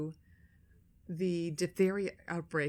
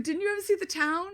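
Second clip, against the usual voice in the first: incredulous question; 10 semitones higher, 3 dB louder than usual. Retold or imitated. Retells question to son.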